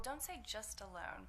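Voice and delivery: ordinary talk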